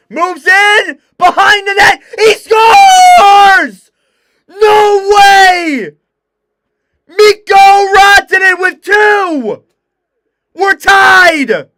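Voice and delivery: huge high shout, voice breaking; voice-cracking goal scream. Real, not acted. Commentator calling the tying goal, voice-cracking scream. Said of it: "my voice cracked so hard".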